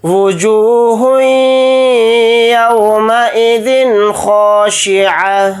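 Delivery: slow melodic tajweed chant; measured Quranic recitation. Shown as a demonstration. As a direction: recite slowly, stretching each vowel. Continues recitation.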